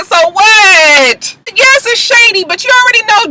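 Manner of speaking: high, loud, crying-out "So what?"; exasperated shout. Real, not acted. Vlogger shouting in exasperation at the cast's shady drama. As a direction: throw it out loud in disbelief. Exclaims about shady comment.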